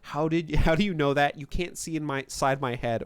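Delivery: ordinary talk, nothing marked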